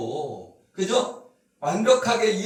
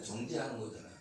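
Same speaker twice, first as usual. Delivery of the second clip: much softer, lower repeat of 정죄하는 거잖아요; soft echo; 8 semitones lower, 14 dB quieter, faster than usual. Real, not acted. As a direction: let 정죄하는 fall away quietly.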